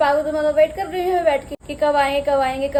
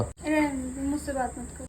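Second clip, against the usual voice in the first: sulking; 7 dB quieter than usual. Real, not acted. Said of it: "आज थोड़ा मुंह फुला के बैठी हुई है".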